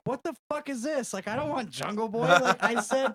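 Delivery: mock fury at the wrong match; imitating Hangman's exasperation; angry complaint. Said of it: "later he's like".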